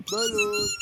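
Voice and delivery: very high, loud vendor call; balut vendor cry. Pretend.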